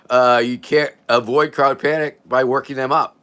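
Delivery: ordinary talk, nothing marked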